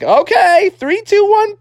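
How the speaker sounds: very high countdown; squeaky-high kid bracing to leap; young frog son. Acted. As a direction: count yourself into the jump as a little frog. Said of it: "And Froggy's like".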